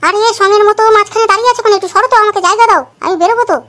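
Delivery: annoyed wife telling husband to step aside; irritated scolding. Acted. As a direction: snap at him to move out of the way. Wife scolds husband blocking doorway.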